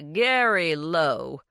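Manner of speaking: slow, stretched snobbish drawl; elongated drawl. A narrator's reading. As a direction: draw the name out like blue blood. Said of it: "in an elongated drawl".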